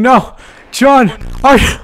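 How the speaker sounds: loud, high cry of dismay; alarmed shout. Real, not acted. Gamer watching John fly off, loud alarmed yell. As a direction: yell in disbelief as John flies. Air balloon takes John.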